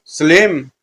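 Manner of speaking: name called out, rising then falling; drawn-out acted call; eerie calling out. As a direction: call the name across a distance. Someone calls out to Salim.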